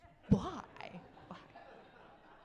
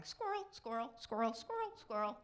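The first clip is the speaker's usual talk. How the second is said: distractible dog; 2 semitones higher than usual. Acted. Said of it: "like the dog in the backyard going".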